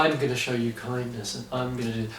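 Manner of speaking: soft, hushed, creepy preacher voice; mock soft predatory preacher. Podcaster mocks the preacher to his co-host, soft and creepy.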